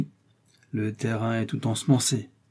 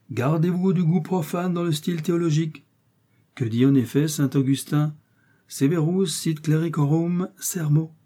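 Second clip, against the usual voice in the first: higher-pitched, sententious cleric; raised Jesuit voice, measured on the Latin; admonishing Jesuit; 6 semitones higher than usual. A narrator's reading. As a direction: warn like a theologian, pitch held up. Jesuit lectures in the story.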